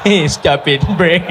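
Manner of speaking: giggling through the words; helplessly amused mock scolding; laughing echo. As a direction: repeat the scolding while cracking up.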